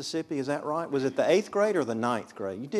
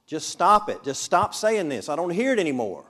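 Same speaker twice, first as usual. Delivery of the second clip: louder and higher, fed up; exasperated outburst; 5 semitones higher, 5 dB louder than usual. Shown as a demonstration. Ruth snapping at Naomi to stop urging her, loud outburst. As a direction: let it burst out at her. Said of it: "re-say that at, like this".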